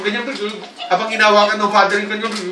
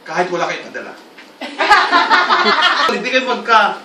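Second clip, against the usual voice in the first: ordinary delivery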